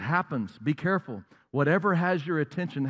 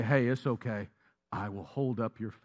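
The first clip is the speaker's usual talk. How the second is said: much lower, much softer comforting voice; reassuring friend; 6 semitones lower, 7 dB quieter than usual. Retold or imitated. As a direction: gently lift a tired friend up. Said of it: "they'll say".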